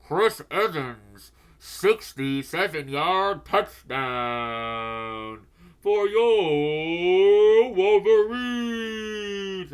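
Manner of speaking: very slow, loud, rising PA call; drawn-out hammed-up announcer touchdown call; stadium announcer. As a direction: boom out the touchdown like the stadium speaker. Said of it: "And then we hear".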